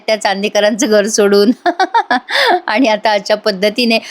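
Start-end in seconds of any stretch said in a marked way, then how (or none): 1.6-2.6 short high amused laugh. Real, not acted. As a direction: burst out laughing at the scene. Narrator laughing at the fight. (मोकळं हसू)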